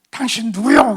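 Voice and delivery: sharp loud challenge; startled demand. Retold or imitated. The stranger demanding who is here, loud and sharp. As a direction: bark the question at the intruder. Angry stranger confronts him.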